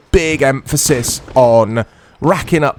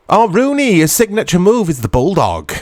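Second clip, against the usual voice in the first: ordinary delivery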